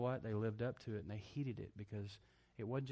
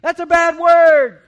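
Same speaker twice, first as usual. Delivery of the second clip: put-on very high, much louder, slow child's scolding call; Rachel, little daughter; 26 dB louder, slower than usual. Father imitating his daughter scolding him from afar, high child's call. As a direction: call it across the house like a small girl telling off her dad. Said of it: "Rachel from the other end of the house said".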